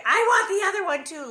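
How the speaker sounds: high, loud, rushed mock wail; hammed-up child's tantrum, fast and loud; sobbing. A narrator's reading. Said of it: "Laura sobbed".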